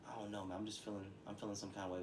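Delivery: soft, low, noncommittal reply; subdued, unsure murmur to a friend; low-key uncertainty. Acted. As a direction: act out confiding that you feel off. Acting out himself confiding, unsure.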